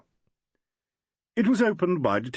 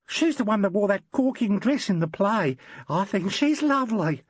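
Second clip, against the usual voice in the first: mock girlish; 9 semitones higher than usual. Packer mocking the girl.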